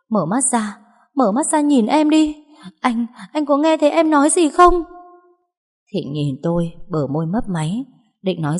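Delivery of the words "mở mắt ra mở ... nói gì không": cao giọng dồn dập, kêu to hoảng hốt; gào gọi người bị thương mở mắt; gào lên. A narrator's reading. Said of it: "ôm chầm lấy anh rồi gào lên". (gào gọi hoảng hốt)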